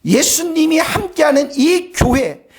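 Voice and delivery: usual speaking voice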